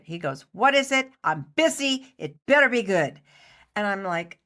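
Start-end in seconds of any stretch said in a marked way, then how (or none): 0.5-3.2 snappish, higher and louder; impatient director. Retold or imitated. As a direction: brush her off, he's busy. Busy director snaps at Candy.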